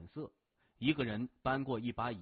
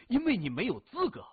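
higher, louder, sudden jab of contempt; scornful put-down; 9 semitones higher, 5 dB louder, faster than usual. A narrator's reading. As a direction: put the captive sharply in his place. Captor puts hostage in his place. (突然抬高声音，轻蔑地训斥)

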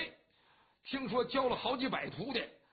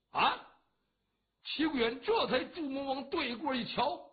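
ordinary delivery, nothing marked

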